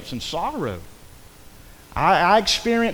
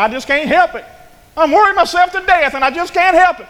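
high, loud whining mock complaint; mock a fretful worrier, pitched up; imitating a whiny worrier; whiny complainer; 10 semitones higher, 7 dB louder than usual. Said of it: "You ever heard somebody".

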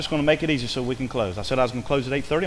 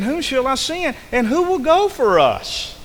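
voice of the Lord; 10 semitones higher than usual. Acted. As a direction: send the summons out high. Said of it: "the voice of the Lord saying".

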